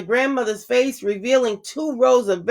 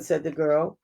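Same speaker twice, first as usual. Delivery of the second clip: ordinary delivery, nothing marked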